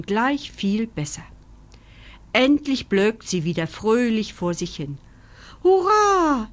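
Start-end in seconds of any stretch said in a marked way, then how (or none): 5.6-6.5 hohes, lautes Hurra; Jubel. A narrator's reading. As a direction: jubelnd ausrufen. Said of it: "jubeln Carlos". (lauter Jubelruf der Freunde)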